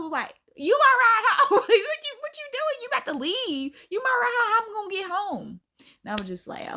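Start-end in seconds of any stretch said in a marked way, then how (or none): 0.5-5.6 shocked. Retold or imitated. Cousin outraged her ride is leaving.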